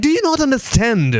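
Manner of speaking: high-pitched, exasperated retold question; mock exasperation. Gamer to a child who keeps asking, exasperated. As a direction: act fed up with being asked.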